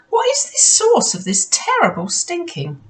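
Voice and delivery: high, wondering question; high-pitched baffled wondering; puzzled surprise. Acted. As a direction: puzzle over the stink. Ada puzzling over stench.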